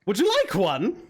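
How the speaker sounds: eager hag, lilting and unhurried; hag character. Acted. Hag offers to build them a creature, eager hag voice. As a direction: make a creepy offer in the hag's voice.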